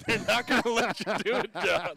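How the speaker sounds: teasing through a laugh. Real, not acted. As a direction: laugh while you tease him. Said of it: "I'm trying to flow into these touchdown calls".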